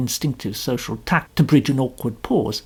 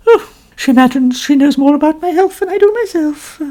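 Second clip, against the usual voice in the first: much higher, louder complaining lady; complaining novelist; 11 semitones higher, 7 dB louder than usual. A narrator's reading. Mrs Otterbourne boasting to Poirot, high and complaining. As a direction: preen and grumble at once.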